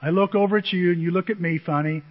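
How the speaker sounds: ordinary talk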